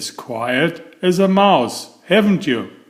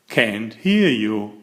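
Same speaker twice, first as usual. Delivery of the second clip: usual speaking voice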